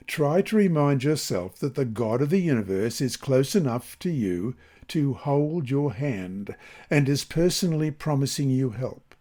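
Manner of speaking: ordinary talk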